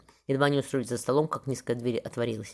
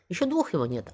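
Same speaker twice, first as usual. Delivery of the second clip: raised pitch, touch of surprise; lightly startled, higher voice; mild gasp; 6 semitones higher than usual. A narrator's reading. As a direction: exclaim it with a small, understated gasp. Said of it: "ахнула Марфа".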